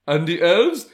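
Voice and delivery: high, loud, eager question; acted excitement. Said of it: "He's so excited".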